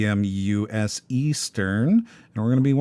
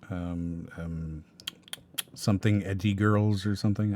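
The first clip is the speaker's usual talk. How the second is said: ordinary delivery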